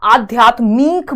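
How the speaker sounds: "आध्यात्मिक" with the final मिक drawn out to मीक; long ई misspelling. Shown as a demonstration. Teacher showing a spelling mistake, "आध्यात्मिक" slowed, ending in long मीक. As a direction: stretch the last vowel of "आध्यात्मिक" into long ई. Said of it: "बड़ी की मात्रा लगा दी".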